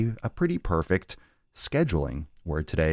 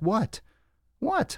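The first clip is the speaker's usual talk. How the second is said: squeaky high "what" of disbelief; mock disbelief. Fan outraged at cranberry sauce in May, squeaky shocked voice. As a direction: act shocked, voice jumping up high.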